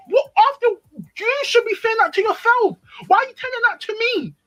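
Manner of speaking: high-pitched, incredulous indignation; outraged confrontation. Retold or imitated. Acted outraged confrontation with boyfriend.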